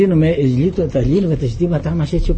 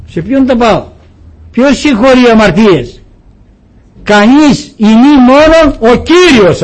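higher, louder, slowing at the end; preaching at full force; 7 semitones higher, 9 dB louder than usual. Acted. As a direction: proclaim it, stretch the last words. Preaching climax on forgiveness. (κήρυγμα με δύναμη, ψηλά και δυνατά, αργό τέλος)

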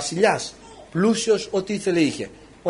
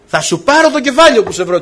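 higher, louder menacing threat; threatening queen; 7 semitones higher, 6 dB louder than usual. Acted. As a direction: spit the threat at the prophet. Jezebel threatens Elijah. (η Ιεζάβελ απειλεί τον Ηλία, ψηλά και δυνατά)

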